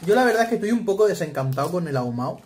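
chewing then laughing; talking while chewing. Real, not acted. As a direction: mouth partly full of chips. Seen 1: man bit a chip at -1; jaw closed, chewing early.

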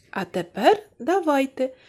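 usual speaking voice

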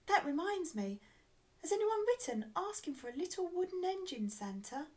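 high, lively elf voice; little elf. A narrator's reading. Elf asking Santa about a letter, high elf voice. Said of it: "cried the little Christmas elf".

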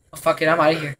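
ordinary delivery

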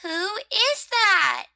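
high, slow demand; suspicious rosebud. Acted. Rosebud to the rustler at the keyhole, demanding. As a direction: draw the question out high.

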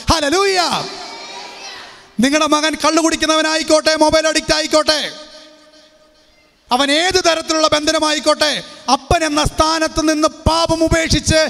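high, ringing preacher's call; preaching at full force. Acted. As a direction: cry hallelujah and exhort the fathers. Preacher returns to exhortation. (ആവേശത്തോടെ ഉറക്കെ പ്രസംഗിക്കുന്ന ശൈലി)

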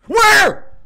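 sudden high yell of alarm; shrieking where; startled shout. Real, not acted. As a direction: scream it out in shock. Sudden scare in game.

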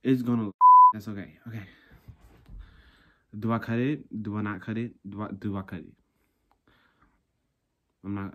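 normal talking voice

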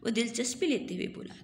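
ordinary delivery

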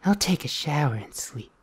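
low, hushed come-on; seductive. Acted. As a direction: drop way down and purr it seductively. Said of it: "So seductive".

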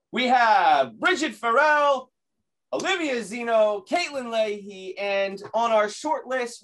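booming ring announcer, high and loud, stretching names; wrestling announcer voice. Pretend. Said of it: "do my wrestling, my wrestling announcer voice".

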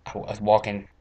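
normal talking voice

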